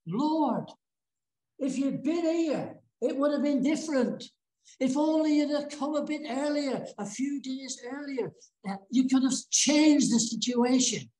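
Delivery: high-pitched, earnest retold plea; retold reproach. Martha to Jesus after her brother's death, high reproachful plea. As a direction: act out a mild reproach, pitched well up.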